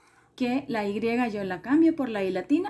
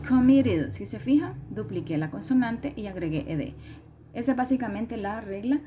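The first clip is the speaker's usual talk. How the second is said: ordinary delivery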